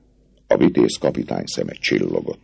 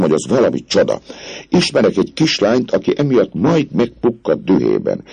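higher, eager voice of a smooth-talking captain; the captain sweet-talking the girl, eager and higher; dashing captain; 9 semitones higher than usual. A narrator's reading. Phoebus voiced by narrator.

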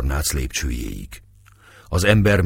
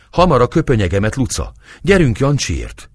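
urgent command; 6 semitones higher, 3 dB louder than usual. A narrator's reading. Urgent order to the maid.

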